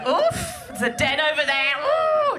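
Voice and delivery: put-on high, thrilled squeals at the young men; playful cougar delight at the crowd; excited cougar. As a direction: squeal like a cougar spotting prey.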